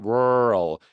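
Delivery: "world" stretched, R gliding into L; R pulled to L. Shown as a demonstration. Teacher showing the tongue moving forward, drawn-out R-L in "world". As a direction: slowly pull "world" from back R to front L. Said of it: "뒤에 있다가 앞쪽으로 당겨져야".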